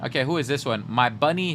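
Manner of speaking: ordinary talk, nothing marked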